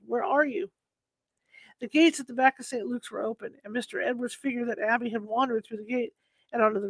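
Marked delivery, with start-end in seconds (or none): none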